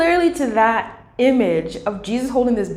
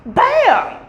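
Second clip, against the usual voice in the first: high loud bam; bam sound effect; 13 semitones higher, 4 dB louder than usual. Retold or imitated. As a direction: punch it like a lightning crack. Lightning strike sound effect.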